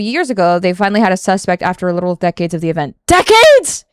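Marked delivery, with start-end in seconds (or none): 3.1-3.8 high, loud yelp of disbelief; incredulous. Real, not acted. Streamer hearing of decades-long case, incredulous yelp. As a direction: yelp it in shock.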